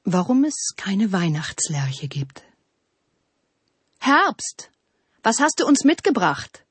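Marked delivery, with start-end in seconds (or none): 4.0-6.7 higher-pitched expectant call. A narrator's reading. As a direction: call to Autumn as the eager trees. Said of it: "riefen die Bäume". (fordernd rufend)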